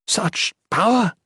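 high, slow awed exclamation; stunned by the force; exclaiming. Acted. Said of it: "Padre Nolan exclaimed".

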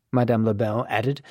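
plain reading voice

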